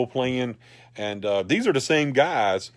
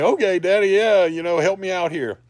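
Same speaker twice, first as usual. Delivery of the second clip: put-on high, eager little-kid plea; 9 semitones higher, 6 dB louder than usual. Young son begging his dad for a costume idea, high and eager. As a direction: play the excited child asking daddy.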